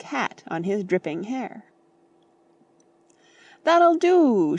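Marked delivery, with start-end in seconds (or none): 3.6-4.6 high, loud stop-it shout; a loud, high call to order. A narrator's reading. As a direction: bellow it to end the game. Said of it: "shouted Mister Beebe".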